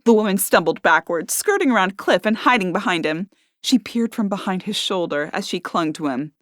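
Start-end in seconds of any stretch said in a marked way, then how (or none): none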